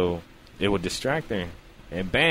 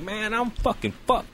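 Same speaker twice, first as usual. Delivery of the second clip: high despair; 12 semitones higher than usual. Retold or imitated. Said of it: "So I'm like".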